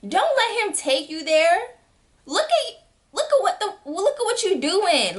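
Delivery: high, urgent pleading; pleading advice. Retold or imitated. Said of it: "I'm like".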